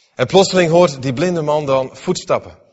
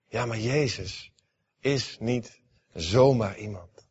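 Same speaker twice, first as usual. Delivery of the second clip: hushed conviction; 4 semitones lower, 7 dB quieter, slower than usual. Acted. Preacher quietly stresses conviction.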